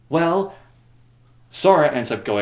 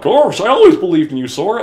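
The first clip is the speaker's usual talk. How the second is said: higher, louder goofy cartoon voice; Goofy impression; 8 semitones higher, 10 dB louder than usual. Goofy telling Sora he believed in him, cartoon voice. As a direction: do a dopey cartoon sidekick.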